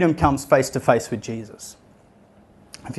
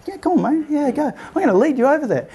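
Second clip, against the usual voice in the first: eager crowd; 12 semitones higher than usual. Retold or imitated. Crowd eagerly leading beggar.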